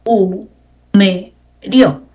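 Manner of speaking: syllable by syllable. Shown as a demonstration. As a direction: split into sa, u, me, rio with gaps. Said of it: "yo voy a decir".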